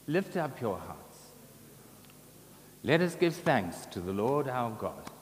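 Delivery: normal talking voice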